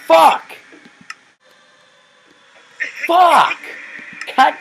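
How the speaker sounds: loud, high barked swearword; real burst of exasperation; frustrated cursing. Real, not acted. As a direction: snap it at the game. Repeated failures in game.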